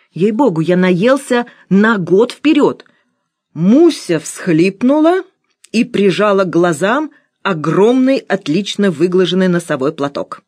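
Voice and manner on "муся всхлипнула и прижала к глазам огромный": usual speaking voice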